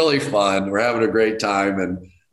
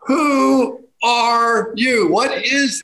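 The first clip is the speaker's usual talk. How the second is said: high, slow, strained cry of disbelief; exasperated disbelief; 11 semitones higher than usual. Retold or imitated. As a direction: drag out the bewildered question, near breaking. Baffled by expert caller.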